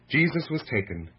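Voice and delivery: plain reading voice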